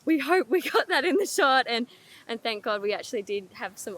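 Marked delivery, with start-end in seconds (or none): 0.1-1.7 high, loud, bouncy crew quote; excited re-enactment. Retold or imitated. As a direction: act out giddy hope after a lucky moment. Acting out crew's hopeful reaction.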